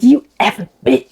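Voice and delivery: a hint of a gruff man; lightly dropped, mannish snarl; manly voice. Sweet friend cursing the waitress, lightly mannish voice. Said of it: "a totally different voice like a man".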